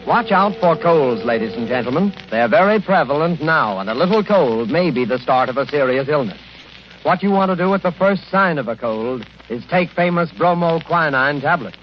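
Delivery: ordinary talk, nothing marked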